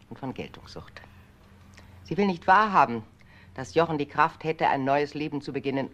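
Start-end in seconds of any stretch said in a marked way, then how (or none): 0.1-1.0 contemptuous whisper. Acted. Mother condemning daughter-in-law.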